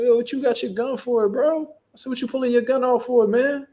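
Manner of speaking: high-pitched retold disbelief; mock alarmed protest. Driver questions officer's drawn gun, high mock alarm. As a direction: question him incredulously, pitch way up.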